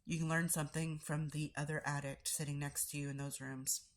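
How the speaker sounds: ordinary talk, nothing marked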